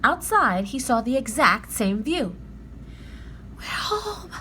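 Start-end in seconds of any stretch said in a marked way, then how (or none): 3.6-4.3 high, soft hushed words; whispering. A narrator's reading. Annie in the still treehouse, hushed whisper. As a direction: breathe it in wonder. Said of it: "whispered Annie".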